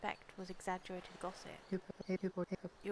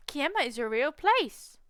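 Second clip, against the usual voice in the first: higher, much louder exclamation; amazed discovery; 5 semitones higher, 10 dB louder than usual. Real, not acted. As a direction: burst out in real astonishment. Discovers Kiama really exists.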